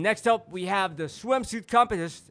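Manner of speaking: pageant host. Retold or imitated. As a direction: call the next segment like an emcee. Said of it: "So, like, yan".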